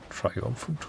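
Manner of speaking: normal talking voice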